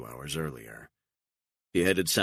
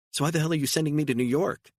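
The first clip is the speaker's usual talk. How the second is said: much higher, louder, fast exasperated cry; exasperated outburst; 13 semitones higher, 6 dB louder, faster than usual. Acted. Beatrice demanding answers from Stone, loud and fast. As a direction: burst out in disbelief.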